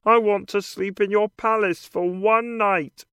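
lightly voiced frog character; a hint of a croaky frog. A narrator's reading. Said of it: "Then the frog says".

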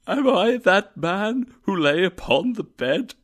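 high, anguished cry; fearful cry. A narrator's reading. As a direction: cry out in dread. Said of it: "he cried".

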